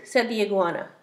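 ordinary delivery

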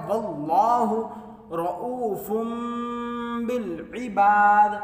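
slow, drawn-out tajweed chant; chanted Quranic recitation. Shown as a demonstration. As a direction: recite melodically, stretching the vowels. Recitation after explanation.

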